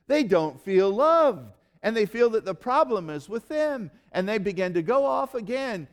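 a plea that climbs and then stretches out; impassioned preaching. Acted. Preacher to congregation on feeling unloved, building urgency. Preaching rising to full force.

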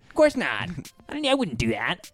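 lightly voiced denying friend; quoting friend.

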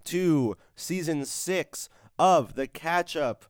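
slow, drawn-out announcer welcome; big energetic podcast opener; show host intro. Acted. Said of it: "Somehow I turned on the energy".

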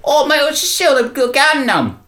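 put-on high, hurried kid's reply; young runner. Runner answering the crime boss, high-pitched kid voice. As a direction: play the little runner explaining himself. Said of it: "And he says".

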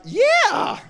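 put-on squeaky high toddler yelling yeah. Dad imitating his toddler's high excited yeah. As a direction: bounce it out like a thrilled little girl. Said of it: "And she's like".

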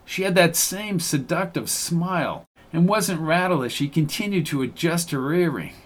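plain reading voice

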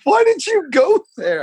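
laughing high and loud through the words; words carried on a loud laugh. Real, not acted. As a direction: crack up while asking. Laughing after the punchline.

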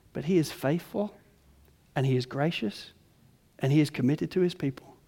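hushed, sincere declaration; reverent, soft. Real, not acted. Reverent softening after mockery.

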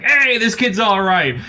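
high, drawn-out mobster cheer; hammed-up approving wiseguy shout; movie mob guys. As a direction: welcome him like a movie gangster. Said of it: "all the mob guys are like".

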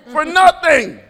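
loud, laughing self-mockery; laughing loudly at himself; laughing shout. Real, not acted. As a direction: shout it out, cracking up. Laughing shout at own dream.

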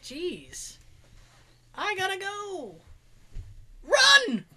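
building urgency. Retold or imitated. Urgent need to pee.

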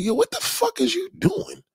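high-pitched, incredulous mock scold; mock scolding manager. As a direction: squeak it out like a disbelieving manager. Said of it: "I'm like".

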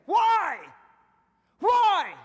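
high, loud preacher's cry; shouted rhetorical question. Real, not acted. Preacher demanding why one believer stays down, shouted.

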